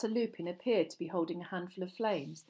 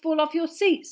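awkward; 10 semitones higher, 8 dB louder than usual. A narrator's reading. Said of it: "said Harry awkwardly".

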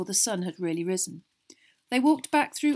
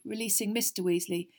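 usual speaking voice

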